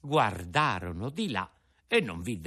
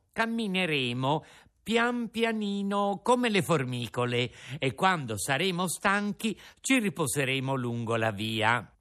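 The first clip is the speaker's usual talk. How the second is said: put-on a slightly higher, eager puppet boy; a hint of a kind young puppet; lightly voiced Pinocchio; 7 semitones higher than usual. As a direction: gently encourage the old father. Pinocchio to Geppetto.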